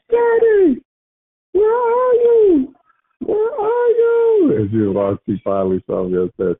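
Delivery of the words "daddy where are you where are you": put-on high, slow calling like a small child; child's voice. Father imitating his daughter calling for him, high and slow. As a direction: call out like a lost little girl.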